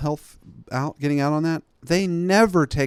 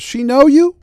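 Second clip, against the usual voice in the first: much higher, loud questioning; shrill clerk voice; 13 semitones higher, 12 dB louder than usual. Retold or imitated. Clerk grilling the victim on the phone, high and loud. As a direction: fire the questions at her in a high squeal.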